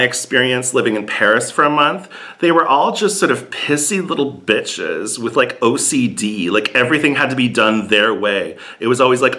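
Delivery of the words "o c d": ordinary talk, nothing marked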